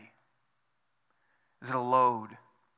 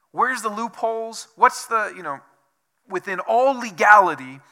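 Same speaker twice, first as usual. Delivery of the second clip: loud, high, greedy taxpayer voice; mock tax dodger; 5 semitones higher, 13 dB louder than usual. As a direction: ham up the loophole hunter, louder and higher. Mocking tax loophole seeker.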